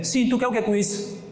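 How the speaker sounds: mock judgmental retort, pitched high; judging snap. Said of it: "uma coisa é". (pergunta julgadora em tom agudo)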